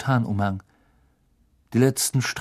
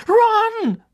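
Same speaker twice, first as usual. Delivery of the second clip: much higher, loud call after him; 7 dB louder than usual. Acted. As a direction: shout his name as he runs off. Calling after running Ron.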